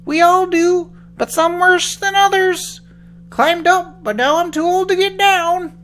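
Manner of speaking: put-on high, loud, slow old man. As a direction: play a frail old man. Old man in tree tells.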